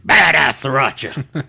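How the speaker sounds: slow, drawn-out wrestler growl; Goldberg impression. Reprising the Goldberg food order for sriracha, slow mock bellow. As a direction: stretch it out like a mock wrestling promo. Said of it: "I really hope Goldberg sounds the way he does".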